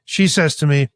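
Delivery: ordinary talk, nothing marked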